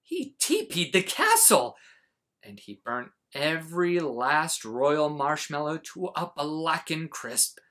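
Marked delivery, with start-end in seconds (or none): none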